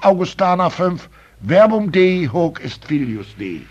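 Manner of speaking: loud, slow, fervent pulpit preaching; preaching at full force. Acted. Preacher urging the church to give real bread, loud fervent preaching. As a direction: thunder it from the pulpit to the congregation.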